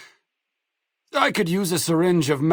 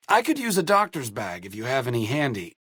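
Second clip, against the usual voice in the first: ordinary talk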